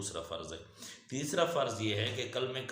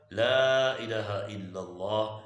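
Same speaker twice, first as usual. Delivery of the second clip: loud, slow recitation with a long first vowel; drawn-out, ringing Arabic phrase; kalima recitation; 7 dB louder, much slower than usual. Real, not acted. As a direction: proclaim it, stretching the vowels. Recites before giving the translation.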